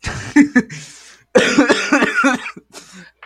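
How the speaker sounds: high, loud laughing fit; loud burst of laughter. Real, not acted. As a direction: explode into laughter. Reacts to dark joke.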